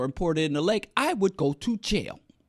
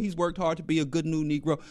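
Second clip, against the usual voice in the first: ordinary talk, nothing marked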